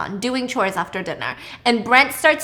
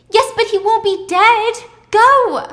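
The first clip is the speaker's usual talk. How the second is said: much higher and louder, shove him out the door; urgent order; 10 semitones higher, 7 dB louder, slower than usual. Retold or imitated. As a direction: snap out the command, no time. Wife ordering husband out.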